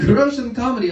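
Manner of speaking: high, loud mock-exasperated repeat; insistent dad. Dad insisting, repeats himself louder.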